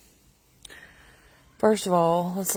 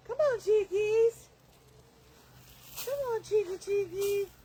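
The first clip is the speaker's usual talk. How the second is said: high coaxing call to hens; calling chickens; 11 semitones higher, 6 dB quieter than usual. Real, not acted. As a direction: coax the chickens over, high.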